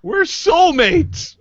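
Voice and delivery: high, slow, swooning declaration; mock-dramatic swoon. Host to guest Darren over their matching cups, swooning mock romance. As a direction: gush it like a lovestruck fan.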